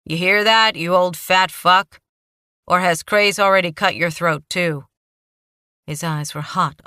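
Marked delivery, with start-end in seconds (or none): 0.0-4.9 calling out louder to someone out of sight; raised voice. A narrator's reading. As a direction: shout it down the hall, taunting. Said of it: "Marky raised his voice".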